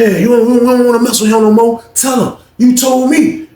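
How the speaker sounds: high, pushy retold urging; insistent prompting. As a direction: act out pressing someone to answer.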